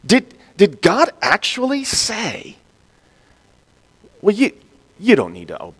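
soft then rising, insinuating questions; mock serpent. Preacher voicing the tempting serpent, insinuating. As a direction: sneer God's command away.

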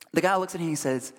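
ordinary talk, nothing marked

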